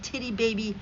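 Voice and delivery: ordinary talk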